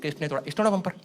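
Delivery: put-on softened cop questioning in baby talk; cop asking in baby talk. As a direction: policeman playing along in baby talk. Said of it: "कर".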